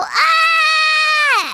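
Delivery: high sung wail of sorrow. Acted. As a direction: let out a long cartoon cry. Pet wails.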